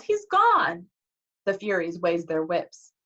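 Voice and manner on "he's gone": high-pitched loud yell; Annabeth yelling. A narrator's reading. Girl to the whip-lashing Furies, high yell. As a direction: shout it high to the attackers. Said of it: "Annabeth yelled".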